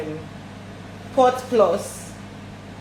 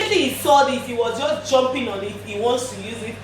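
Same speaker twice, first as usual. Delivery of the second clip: normal talking voice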